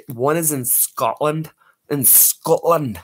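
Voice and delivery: put-on Scottish accent. Playful Scottish accent bit.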